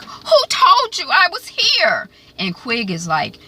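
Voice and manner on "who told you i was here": high, slow alarmed question; mock alarm, pitched up high; Emily alarmed. Retelling Emily snatched from Nancy's house, high alarmed voice.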